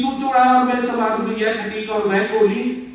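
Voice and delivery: ringing stage recital of a verse; full-voiced verse recitation.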